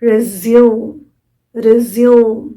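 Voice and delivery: रिज्यूम said ri-zyoom, stress on second syllable; pronounced resume. Shown as a demonstration. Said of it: "तो होगा". (रिज्यूम में ज़्यूम पर ज़ोर)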